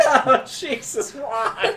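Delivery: high-pitched, drawn-out mock scream. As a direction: cry out slowly like a panicked bystander, half laughing. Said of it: "People across the hallway screaming".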